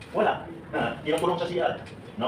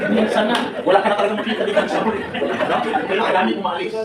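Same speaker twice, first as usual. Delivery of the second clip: laughing through the words, pitched up and louder; 5 semitones higher, 5 dB louder than usual. Real, not acted. Speaker joking with seminar crowd about leaving, laughing. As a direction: chuckle it out loud while joking to the crowd.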